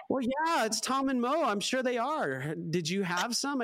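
a lightly hammed-up excited friend; high mock woman's voice. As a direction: voice her high and excited, a few steps up. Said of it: "And she's like".

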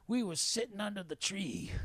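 high-pitched imitated old man; put-on voice of an older Southern stranger; Bay. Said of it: "He goes".

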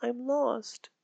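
put-on high, soft little girl's voice, a touch tearful; small lost girl near tears; quietly voiced frightened child; sniffling lost child. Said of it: "Mary answered with a sniffle".

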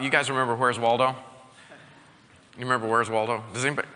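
ordinary talk

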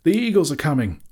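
high, loud announcing cry; loud cry, falling at the end; excited shout. A narrator's reading. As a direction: shout the news out across a battlefield. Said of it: "he shouted".

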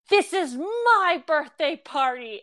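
hammed-up birthday boy showing off; indignant birthday boy. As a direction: proclaim it like a kid claiming his own party. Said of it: "he will go".

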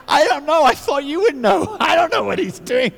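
laughing disbelief. Retold or imitated. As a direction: play the clueless newcomer with a laugh. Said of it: "I was like".

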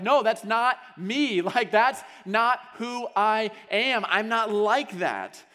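slow, loud, high protest; denying the affair, shouting to the audience; shouting denial. Retold or imitated. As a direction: shout it at the crowd, refusing the truth. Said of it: "shout out to the crowd".

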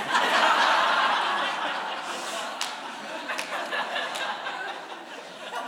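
long airy laugh climbing high; drawn-out breathy laugh rising up. Real, not acted. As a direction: laugh at your own embarrassment. Laughs at own shame.

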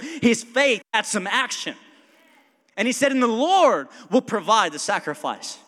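loud, rising preacher's call about Abraham's action; fiery preaching. Acted. Pastor urging the congregation, preached loud and high. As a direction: build the room up, pushing each line harder.

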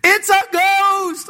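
high, slow, shouted fright; hammed-up scream of a spooked disciple; mock terrified scream. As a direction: scream it like you've seen a ghost. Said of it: "what do they scream".

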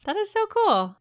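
high, thrilled "so cool"; excited squeal. Real, not acted. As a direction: squeal it with delight. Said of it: "high squeal of delight".

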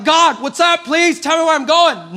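loud, high plea to God; exasperated plea. Acted. Driver begging God to tell him where, exasperated. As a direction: cry out for an answer from above. Pleading with God while waiting.

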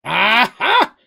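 loud, high cry of discovery; triumphant exclamation. A narrator's reading. Mugen digging in his bag, exclaiming. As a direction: exclaim it like you just found it. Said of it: "exclaimed Mugen".

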